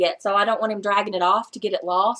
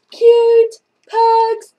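high laughing coo over pugs; 11 semitones higher, 7 dB louder than usual. Real, not acted. Laughing at pug picture.

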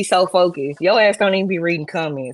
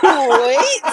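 mock scream; 7 semitones higher, 4 dB louder than usual. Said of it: "just screaming at the comments".

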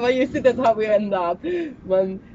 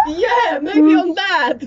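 laughing; 4 semitones higher, 7 dB louder, much slower than usual. Real, not acted. Laughing at dog food.

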